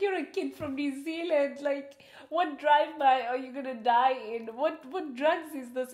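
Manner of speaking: loud, high, scoffing disbelief; a boy's silly fears met with loud, high mocking disbelief; mock incredulous uncle. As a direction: scoff at him like a fed-up uncle. Uncle mocks boy's drive-by fear.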